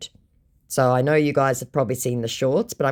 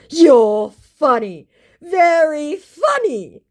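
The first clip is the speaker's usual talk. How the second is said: much higher, slow, smug scammer voice; arrogant mock; 13 semitones higher, 6 dB louder, much slower than usual. Scammer sneers back at the narrator, high and slow. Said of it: "This guy was so arrogant".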